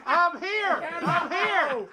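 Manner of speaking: high, tiny I'm here with a laugh; Horton's Who voice. Retold or imitated. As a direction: squeak like a speck-sized Who calling out. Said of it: "I'm like Horton hears a who".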